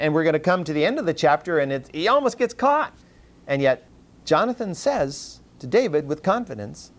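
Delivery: normal talking voice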